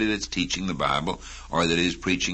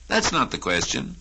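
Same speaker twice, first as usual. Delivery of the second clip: normal talking voice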